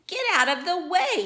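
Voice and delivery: high, loud, fast yell; angry sheep yelling from his jeep. Acted. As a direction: shout him out of the road. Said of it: "he yells with a beep".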